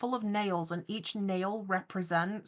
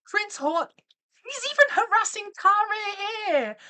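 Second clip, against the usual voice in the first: high, loud indignant squeal; outraged shriek; 13 semitones higher, 8 dB louder than usual. Real, not acted. Catching the rival harassing Tara, outraged high shriek.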